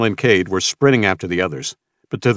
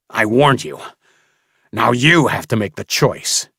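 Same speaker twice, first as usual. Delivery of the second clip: louder menacing growl; 3 semitones higher, 3 dB louder than usual. Acted. Mason threatening Samuel, menacing growl. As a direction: press the threat on him. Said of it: "Mason growled at Samuel".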